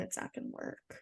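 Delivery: breathless whisper; whispered aside. Real, not acted. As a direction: drop to a whisper. Trailing aside.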